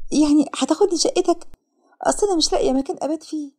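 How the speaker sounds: high, tentative questioning; thoughtful. A narrator's reading. Sara pretending to consider the thug's offer, thoughtful. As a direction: sound as if weighing the offer. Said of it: "وسارة بتفكير".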